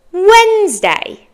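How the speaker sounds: Wednesday slowly as WENZ-day, high then falling; careful model pronunciation. Shown as a demonstration. Teacher answers what day it is, careful pronunciation. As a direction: say Wednesday in two long syllables, "WENZ-day". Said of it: "Can you say Wednesday?".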